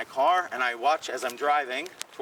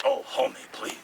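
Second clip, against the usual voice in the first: high, put-on Paul Mooney voice; high, sly imitation of a famous comedian; Paul Mooney impression; 12 semitones higher than usual. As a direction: mimic your old friend Paul Mooney. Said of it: "That's a great impression".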